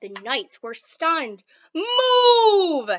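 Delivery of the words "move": much higher, louder barked "Move!"; shout. Acted. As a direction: yell at the boy to get away. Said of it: "they shouted".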